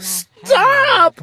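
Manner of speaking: a sister's alarmed high yell; yelled stop. A narrator's reading. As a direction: shout it to cut him off. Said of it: "my sister yelled".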